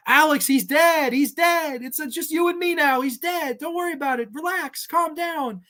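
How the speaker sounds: high, hushed voice, falsely calming the friend; imitating the killer calming her captive friend; mock soothing killer. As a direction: coo it like a fake comforter. Acted quote, killer reassuring friend.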